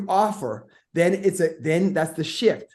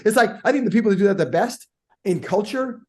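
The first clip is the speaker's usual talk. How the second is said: usual speaking voice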